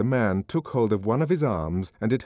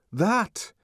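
high, emphatic pointing; lightly voiced Bernard, insisting; Bernard's voice; 11 semitones higher than usual. A narrator's reading.

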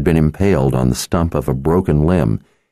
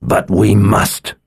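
slow, higher, insistent protest; resurfacing anger; 4 semitones higher, slower than usual. A narrator's reading. Victor insisting on burying his friends, anger resurfacing. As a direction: protest with anger coming back. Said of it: "Victor's anger resurfaced".

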